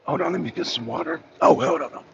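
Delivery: real voice cracking to air; words that barely sound out; lost voice, breathy and broken; voice giving out. Real, not acted. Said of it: "My voice is gone".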